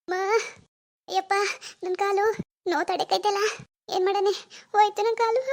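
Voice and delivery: high moans of pain; hammed-up tearful agony; put-on crying. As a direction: whimper and wail over a hurt leg. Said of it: "ಕಾಲ್ ನೋವು ಅಂತ ಅಳ್ತಿದ್ದಾರೆ".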